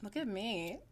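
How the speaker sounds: ordinary talk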